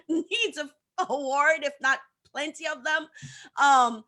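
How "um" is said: ordinary talk, nothing marked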